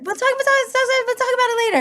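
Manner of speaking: high, sing-song put-off; coy high-pitched stalling of a bursting secret; coy dodge. Retold or imitated. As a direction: brush him off playfully, pitch way up. Hiding news from husband, laughing.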